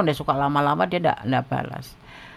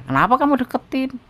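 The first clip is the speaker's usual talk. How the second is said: exasperated question; 10 semitones higher, 5 dB louder than usual. Real, not acted. Scolding a listener's choice.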